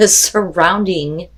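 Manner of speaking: drawn out, laughing at own stumble; slow careful word. Real, not acted. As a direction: say it slowly after tripping over it. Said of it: "I know how to talk".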